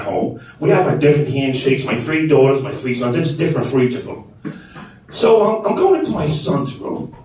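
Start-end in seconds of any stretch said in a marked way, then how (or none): none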